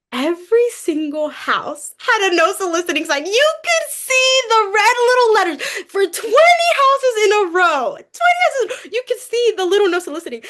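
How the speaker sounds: high-pitched, near-tearful disbelief; overwhelmed. Real, not acted. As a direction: voice climbing into a teary, laughing squeal. Overwhelmed by no-soliciting signs.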